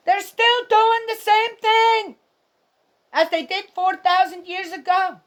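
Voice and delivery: preacher-style exasperated outburst; impassioned near-yell. Real, not acted. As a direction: hammer it home with rising outrage. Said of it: "I know I'm not yelling at you".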